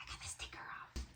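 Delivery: hushed whisper of relief. Real, not acted. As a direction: breathe it out under your breath. Peeling sticker carefully.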